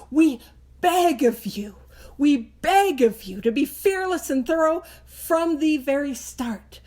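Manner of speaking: impassioned read punching "earnestness" and "beg"; emphatic pleading. Shown as a demonstration. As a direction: plead earnestly, hitting "beg" hard each time. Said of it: "It is emphatic language with emphasis".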